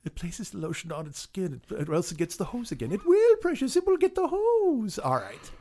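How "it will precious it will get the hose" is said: high creepy creature voice. Acted. Gollum-like killer impression.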